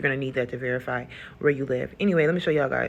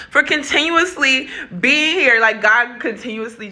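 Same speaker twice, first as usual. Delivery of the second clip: higher, slower, louder retake; excited retake; 8 semitones higher, 6 dB louder than usual. Real, not acted. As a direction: relaunch it with lifted energy. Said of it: "I had to put some lip gloss on".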